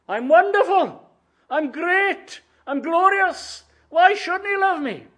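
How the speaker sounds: high-pitched, self-adoring protest; puffed-up sinner in a high voice; boastful sinner. Acted.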